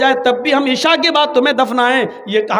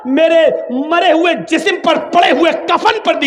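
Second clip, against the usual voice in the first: impassioned shout; 6 semitones higher, 3 dB louder than usual. Real, not acted. Preacher stresses Fatima's words.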